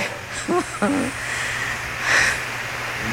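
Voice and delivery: breathy laugh. Real, not acted. Preacher breaks off laughing.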